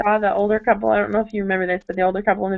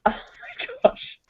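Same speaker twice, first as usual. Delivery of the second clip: breathless whispered gasp, much higher and softer, slow; stunned whisper; 13 semitones higher, 9 dB quieter, slower than usual. Retold or imitated. Reacting to a hard teaching, slow stunned whisper. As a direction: let it slip out as a shocked whisper. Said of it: "And I'm thinking".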